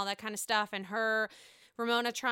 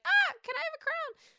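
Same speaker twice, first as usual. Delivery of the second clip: much higher, thrilled squeal of a question; excited Tinsley; 5 dB louder than usual. Retold or imitated. Host voices Tinsley begging for a crown, excited. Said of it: "she's like".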